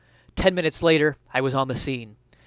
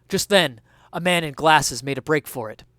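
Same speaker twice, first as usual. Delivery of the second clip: usual speaking voice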